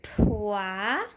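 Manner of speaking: "Poisson" cut as "pois-son" with a rise; sounding out "Poisson" in class; split into syllables. Shown as a demonstration. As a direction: chop "Poisson" in two for a child. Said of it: "Coupons la syllabe".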